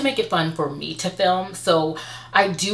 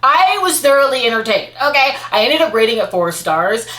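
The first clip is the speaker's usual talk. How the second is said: excited; 6 semitones higher, 6 dB louder than usual. Real, not acted. Emphatic book verdict.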